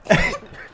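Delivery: sudden high-pitched laugh; short, high burst of a laugh; burst of laughter. Real, not acted. Host reacting to the star's cringe at the joke, sudden laugh.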